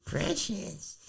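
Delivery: high, hissing creature voice; Gollum. Retold or imitated. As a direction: rasp it out like a cave creature. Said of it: "Sounded like Gollum".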